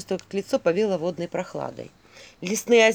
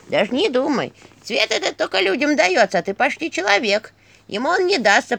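loud, swooping scolding staff; Черепуша; 5 dB louder than usual. A narrator's reading. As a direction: warn the girl off in a loud nagging voice, easing at the end. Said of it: "что она разговаривает". (ворчливый посох, громкое предостережение)

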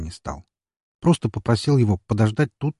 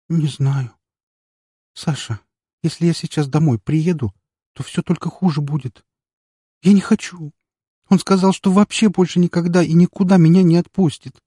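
upset tearful; 9 semitones higher, 5 dB louder than usual. A narrator's reading. Upset crying girl pleads.

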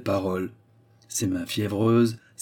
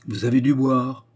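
voix grave, « dû » appuyé, en reproche; 3 semitones higher, 3 dB louder than usual. A narrator's reading. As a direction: accuser gravement l'ami d'avoir bu. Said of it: "fis-je gravement". (Atkinson reproche à son ami d'avoir bu, d'un ton grave)

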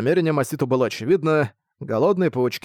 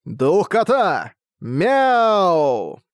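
slow, loud battle cry; shouting; 5 semitones higher, 4 dB louder, much slower than usual. A narrator's reading. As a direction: yell the skill name. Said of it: "он крикнул".

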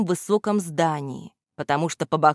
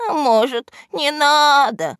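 high, hesitant henchman voice; timid little voice; 9 semitones higher, 5 dB louder than usual. A narrator's reading. Henchman doubting the boss's plan, timid little voice. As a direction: suggest backing out, high and drawn out. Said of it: "заметил слабым голоском вреднюга".

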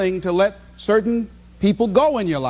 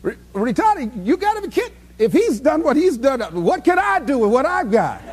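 mock amazed listener; 6 semitones higher than usual. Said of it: "people who look at me and say".

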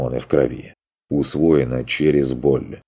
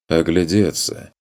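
usual speaking voice

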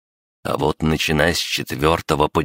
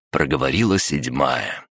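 ordinary delivery, nothing marked

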